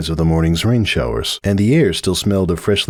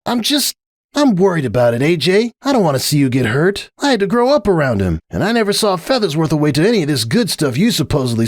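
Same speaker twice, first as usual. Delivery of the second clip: Rainbow Dash; 9 semitones higher than usual. Acted. Rainbow grumbling to Applejack, high scornful tomboy voice. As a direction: drag out the disgust, pitched high.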